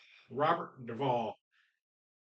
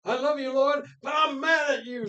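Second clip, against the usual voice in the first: shouted, much higher preacher voice to God; imitating Robert Duvall; 12 semitones higher, 9 dB louder than usual. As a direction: rail at the Lord like a wounded preacher, loud and high. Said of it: "I'm trying to imitate him".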